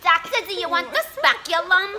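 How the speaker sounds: dreamy failed-actress nurse; ditzy nurse Nancy. Retold or imitated. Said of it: "She doesn't even say it right".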